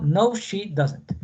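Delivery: drawn-out, careful answer; teacher modelling a negative answer very slowly; very slow model answer. Shown as a demonstration. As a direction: stretch every word for the class. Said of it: "Si es respuesta negativo".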